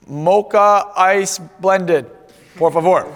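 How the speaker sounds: very slow, spelled-out order to a barista; slow careful ordering. Retold or imitated. Ordering from a barista he thinks speaks no English, very slow. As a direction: drag each word out for someone who might not understand.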